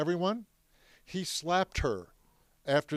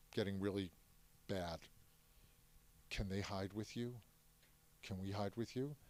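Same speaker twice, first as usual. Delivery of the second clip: much softer and lower, a careful secret ask; barely above a breath, asking for shelter; hushed plea; 5 semitones lower, 12 dB quieter than usual. Retold or imitated. Grandfather asking a young man to hide the family, hushed and low. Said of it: "when he's talking like this".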